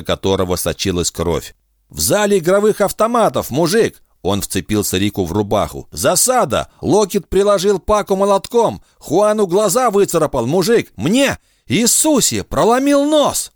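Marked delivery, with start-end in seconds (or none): 1.9-13.5 high, frantic breathless yell; panicked shouting. A narrator's reading. Bloodied Ruben shouts news of the ambush, frantic and high. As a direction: blurt it out in panic.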